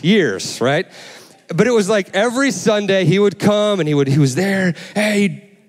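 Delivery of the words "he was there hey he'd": ordinary delivery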